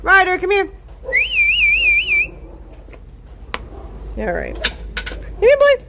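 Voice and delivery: bright call to a dog named Ryder. Real, not acted. Calling the dog over.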